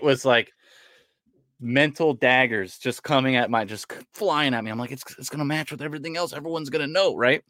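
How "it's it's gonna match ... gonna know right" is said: high, eager pleading; excited pleading. Retold or imitated. Pleading with wife for logo.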